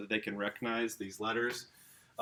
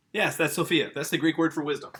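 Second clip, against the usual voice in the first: bursting out higher and louder with the answer; can't hold back the eager reveal; excited; 5 semitones higher, 7 dB louder than usual. Real, not acted. Teacher too eager about Greek, blurting the answer excitedly. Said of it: "I got so excited".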